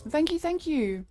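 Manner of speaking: high, loud gushing thank you; excited thanks. Real, not acted. Streamer thanking Nex for a gift, excited. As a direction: squeal your thanks.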